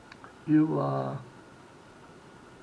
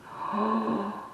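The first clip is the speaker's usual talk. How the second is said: higher, breath-filled oh of awe; imitated amazement; 7 semitones higher than usual. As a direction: mimic an impressed local. Repeats locals' amazed response.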